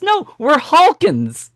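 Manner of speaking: drawn-out, high "No, we're Hawkins"; slow, high mock teacher. Teacher patiently repeats herself to a baffled student, slow and high. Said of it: "She goes".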